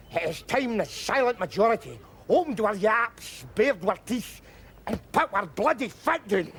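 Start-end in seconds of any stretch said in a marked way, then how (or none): none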